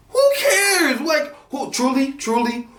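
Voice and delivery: very high, exasperated outburst with a laughing cry; venting at the absurdity. Real, not acted. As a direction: squeal in disbelief.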